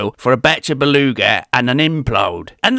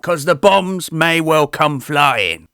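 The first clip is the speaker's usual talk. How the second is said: ordinary delivery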